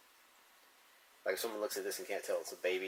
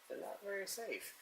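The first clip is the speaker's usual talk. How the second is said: said through a laugh, pitched up high; 13 semitones higher, 4 dB quieter than usual. Real, not acted. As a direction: giggle out the words. Laughing joke about safety pins.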